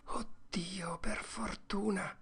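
relieved hushed whisper. Acted. Tom whispers relief to Huck. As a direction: breathe it out in relief. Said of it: "sussurrò Tom".

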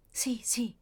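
faint whisper, no voice; murmured whisper. Acted. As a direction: breathe it out, too weak to speak. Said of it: "mormorò lei". (sussurrato debolmente, senza voce)

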